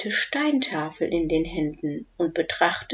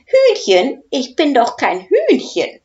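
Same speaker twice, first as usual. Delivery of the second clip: indignant protest; 6 semitones higher, 8 dB louder than usual. Acted. Professor protests being called chicken.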